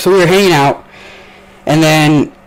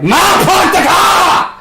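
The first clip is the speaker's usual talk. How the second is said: high, slow Boston-accent holler; shouted Boston accent; 5 dB louder, slower than usual. Shown as a demonstration. Screaming to mom in another room, Boston-accent shout. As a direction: bellow it in a hammed-up Boston accent. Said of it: "like, a Boston accent".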